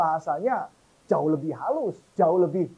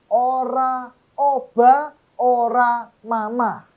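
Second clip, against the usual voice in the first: higher, louder, slow Javanese proverb "Ora obah, ora mamah"; emphatic proverb; 7 semitones higher, 7 dB louder, much slower than usual. Shown as a demonstration. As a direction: proclaim it slowly, each word landing hard. Repeats proverb with force.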